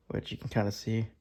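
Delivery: ordinary talk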